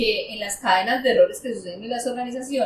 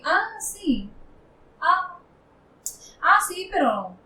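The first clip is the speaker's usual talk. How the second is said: higher-pitched, hesitant imitation of a stalling director; evasive brush-off; 6 semitones higher, 4 dB quieter, slower than usual. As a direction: stall with halting, noncommittal "ah, sí". Acts out evasive financial director.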